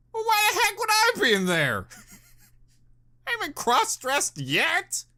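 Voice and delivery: high, giggling question through laughter; laughing. Real, not acted. Reacting to a cross-dressing list, laughing high.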